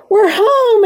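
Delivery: high, loud cry of joy; joyful exclamation. A narrator's reading. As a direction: shout it with delighted relief. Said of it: "exclaimed Virginia".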